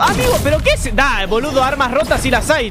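high, loud, worked-up calls; riled-up gamer outburst; excited yelling. Real, not acted. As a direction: keep shouting at the game. Reacting loudly to the game.

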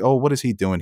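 ordinary talk, nothing marked

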